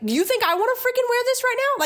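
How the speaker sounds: high, indignant mock retort to a customer; sarcastic retort. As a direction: snap back with scorn, pitched high.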